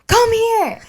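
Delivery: very high, loud call; calling the dog. Retold or imitated. Owner calls her runaway dog over, high and loud. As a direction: yell for him to come, urgent.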